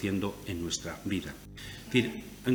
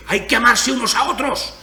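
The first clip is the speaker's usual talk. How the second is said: much higher, much louder mock yell of a preacher; violent shout; 13 semitones higher, 9 dB louder than usual. Preacher mimics a man shouting about love, furious mock yell. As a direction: bellow the words about love with fury. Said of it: "a gritar con violencia y dice".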